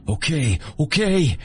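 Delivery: high, rising to a yelp of panic; a beaten man crying out to stop; panicked plea. Acted. Narrator voices Copperhead begging under blows, panicked and high.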